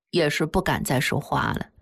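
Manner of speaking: plain reading voice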